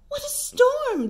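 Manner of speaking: high chirpy bird voice; twittering wren. A narrator's reading. Wren exclaims about the storm, high and chirpy. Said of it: "twitters wren".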